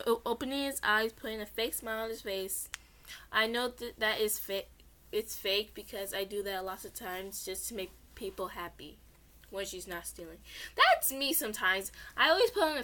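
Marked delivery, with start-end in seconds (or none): none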